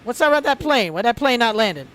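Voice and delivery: loud, high New York heckle; New York accent. Retold or imitated. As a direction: yell it like a brash New Yorker. Mock New York controller heckle.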